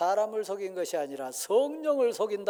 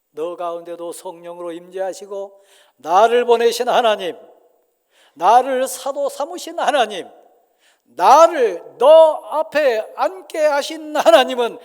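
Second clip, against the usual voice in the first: much louder, higher, slowing stern apostle; thundering proclamation; 5 semitones higher, 18 dB louder than usual. Acted. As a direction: proclaim God's presence with full force. Peter to Sapphira, climax. (베드로가 삽비라에게 크게 선포)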